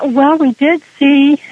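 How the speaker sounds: ordinary talk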